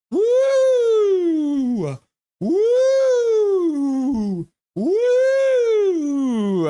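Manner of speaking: long loud high whoops, sliding down; repeated swooping "woo" like a stadium wave; crowd wave whoop. Pretend. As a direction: belt out each whoop, then let it fall. Imitating crowd doing the wave.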